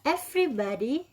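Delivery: slow, clear drill phrases for students to repeat; teacher model reading. Shown as a demonstration. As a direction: model each phrase slowly for the class to copy. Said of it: "Oke, one more time dari atas".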